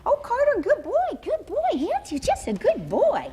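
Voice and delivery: very high, sing-song praise for a dog. Shown as a demonstration. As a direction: gush praise up high like talking to a puppy. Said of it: "The higher pitch your voice tone".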